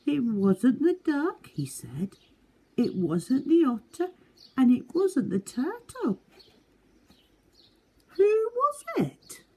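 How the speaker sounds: small high eager animal voice; Little Beaver voice. Acted. Little Beaver asking new animals to be friends, high eager voice. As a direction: play a hopeful little beaver, bright and high.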